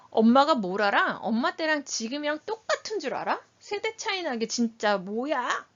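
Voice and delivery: acted child lashing out at mom; scornful, pitch-jumping challenge; confrontational child. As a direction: go at mom accusingly, dragging the last words. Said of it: "막 달겨들듯이 얘기한다고 쳐볼게요".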